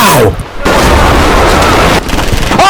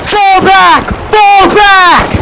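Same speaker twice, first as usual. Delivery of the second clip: high, urgent battle scream; desperate soldier yelling to his squad; screamed retreat; 8 semitones higher, slower than usual. Acted.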